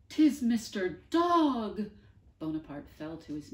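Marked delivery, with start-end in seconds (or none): 0.1-1.9 Bonaparte's slow, very loud joyful cry; awed cry. Acted. Bonaparte hearing the dog's voice from above, a slow loud awed cry. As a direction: call it out in awe.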